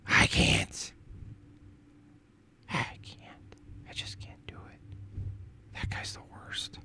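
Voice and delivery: whispered, drained despair; whispering. Real, not acted. Streamer whispering off-mic about the guy, whispered dread. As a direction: barely voice it, just breath. Cringing aside about caller.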